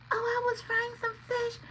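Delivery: high, soft, innocent mock voice; mimicking mother-in-law. Retelling the mother-in-law's excuse in a high soft mock voice. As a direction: play her dumb and sweet. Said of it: "she was like".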